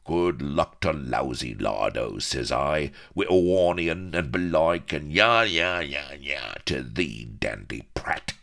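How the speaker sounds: boisterous rustic buccaneer, jeering; loud taunts from a rough sea dog; rowdy, bawling pirate. A narrator's reading. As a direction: crow and scoff like a rowdy pirate.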